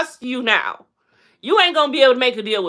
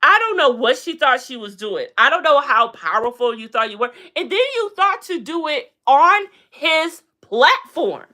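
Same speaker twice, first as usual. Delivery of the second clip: ordinary talk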